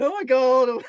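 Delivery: loud, high mock despair; hammed-up exasperated cry; grumpy old man. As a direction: wail it like the old grump again. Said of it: "I am still sat here going".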